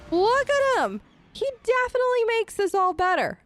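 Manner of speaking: high, delighted gush over a pet; gushing praise. Real, not acted. Player showing off her fox friend, gushing.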